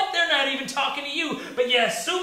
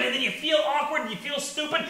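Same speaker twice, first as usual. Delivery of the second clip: ordinary talk, nothing marked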